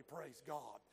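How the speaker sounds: usual speaking voice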